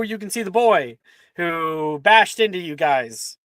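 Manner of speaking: ordinary delivery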